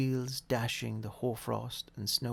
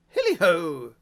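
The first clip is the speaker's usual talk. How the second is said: jolly shout; 4 semitones higher, 11 dB louder than usual. A narrator's reading. Fezziwig cries out.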